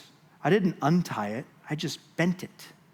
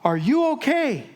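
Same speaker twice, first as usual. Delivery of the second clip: worried coworker; 10 semitones higher, 3 dB louder than usual. Retold or imitated. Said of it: "he comes running over and he goes".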